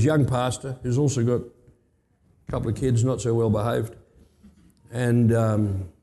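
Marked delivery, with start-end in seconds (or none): none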